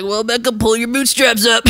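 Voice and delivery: rally yourself with hammed-up resolve; self pep talk. Acted pep talk to herself.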